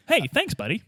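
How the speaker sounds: ordinary talk, nothing marked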